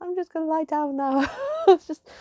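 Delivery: high, quick, mock-faint remark; overwhelmed quote. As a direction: act out being overcome, lightly. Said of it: "I was literally like".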